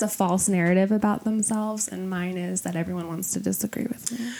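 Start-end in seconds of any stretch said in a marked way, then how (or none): 1.9-4.3 breathy whispered confession; whispering. Real, not acted. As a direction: whisper it like a secret. Confessional aside.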